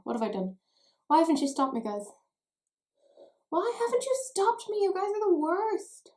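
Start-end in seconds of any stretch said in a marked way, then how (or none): 1.1-2.0 louder, higher mock complaint to the viewers; mock reproach. Mock-blames viewers for her excess. 3.5-6.1 very high-pitched mock wail of blame; over-the-top squeal of reproach; hammed-up mock outrage.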